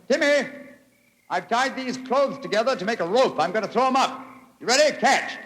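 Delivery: high, loud call up the pit wall. Acted. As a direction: shout up so the boy can hear. Calling up to Timmy.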